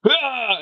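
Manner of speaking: muscle cry. Retold or imitated. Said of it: "muscles started basically going".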